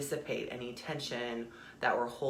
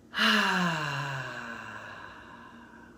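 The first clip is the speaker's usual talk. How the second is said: long, voiced "ahh" on the out-breath; cleansing sigh. Shown as a demonstration. Leading listeners in cleansing breaths, long audible sigh. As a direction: exhale audibly, letting it fall away. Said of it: "like I'm doing, feel free to make noise".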